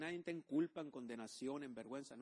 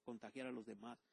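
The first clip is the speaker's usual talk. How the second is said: mock whiny excuse; 4 semitones lower, 8 dB quieter than usual. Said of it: "Niño en Cristo".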